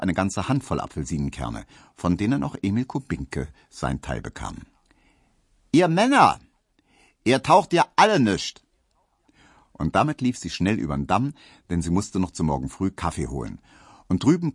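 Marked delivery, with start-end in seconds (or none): 5.7-8.6 high, loud Berlin mock scold; mock scolding maid. Emma scolds all men in jest, high and loud. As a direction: wave them all off with scorn.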